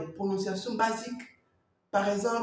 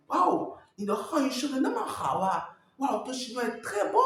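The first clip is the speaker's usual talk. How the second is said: higher-pitched mock amazement; impressed native speaker; 6 semitones higher than usual. Chinese listener praising the learner, mock amazed. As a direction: gush in playful, exaggerated admiration. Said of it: "Ils peuvent dire".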